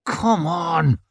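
high, strained urging, out of the whisper; urgent urging. A narrator's reading. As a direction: let the impatience burst out. Whispered urging bursts out louder.

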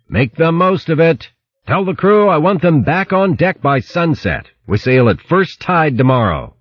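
high, carrying captain's order; calling after them. A narrator's reading. Captain calling orders after departing crew, high carrying call. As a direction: pitch it up to carry ashore. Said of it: "Luke called after them".

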